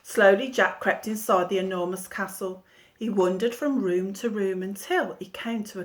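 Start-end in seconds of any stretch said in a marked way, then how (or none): none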